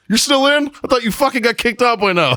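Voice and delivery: high-pitched mock disbelief; imitating the sergeant. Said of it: "And he's like".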